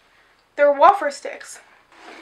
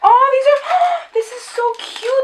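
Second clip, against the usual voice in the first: high, slow, delighted; excited gasp; 9 semitones higher, 3 dB louder than usual. Real, not acted. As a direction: squeal over something cute. Gasps at cute packaging.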